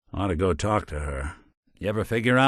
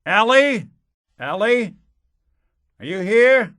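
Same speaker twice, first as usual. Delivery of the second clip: loud, much higher call across an empty room; 13 semitones higher, 6 dB louder than usual. Acted. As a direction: call her name, searching for her. Calling for missing secretary in office.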